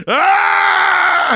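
high, loud held scream; hammed-up horror-movie scream; mock killer scream. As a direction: wail like a movie maniac. Imagining himself as chainsaw killer.

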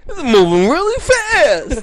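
high, loud, slow Italian wail; mock Italian distress. Co-host playing Italian guy overwhelmed, high mock protest. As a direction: wail it in a comic Italian accent.

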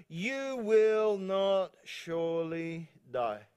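drawn-out, word-by-word tempting; slow, deliberate serpent. Acted. Serpent lying to the woman, slow and deliberate. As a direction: stretch every word out very slowly.

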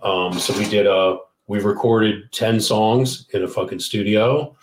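usual speaking voice

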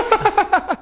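high squeaky laugh; sudden high-pitched giggle; burst of laughter. Real, not acted. Laughs at own tease.

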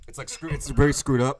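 ordinary talk

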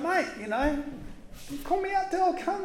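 lightly hammed-up eager fisherman; eager Peter. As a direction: play Peter begging to be called out.

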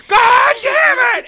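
high, drawn-out comic scream; retold scream, hammed up and stretched long; screamed curse. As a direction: bellow it so the whole park hears. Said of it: "So I'm screaming it".

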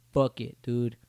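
ordinary talk, nothing marked

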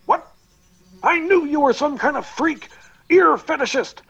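high, loud indignant accusation; alarmed angry shouting; paranoid outburst. Acted. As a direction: shove him off with your voice. Van Gogh recoils over ear remark.

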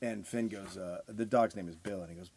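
ordinary delivery

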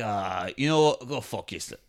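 low, quiet mock giving up; muttered brush-off, imitated; fed-up Louis. Louis losing patience with his hosts, fed-up mutter. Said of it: "Louis kinda like".

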